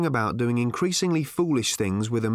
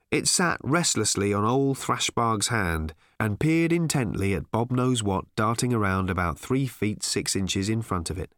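usual speaking voice

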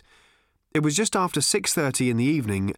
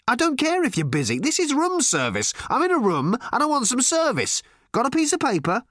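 higher, brisk, impatient phone voice; impatient Ford; 9 semitones higher than usual. A narrator's reading. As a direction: talk down to the hotel clerk.

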